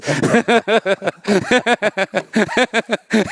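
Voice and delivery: high, long burst of laughter; hearty laughter. Real, not acted. Storyteller laughing at own punchline.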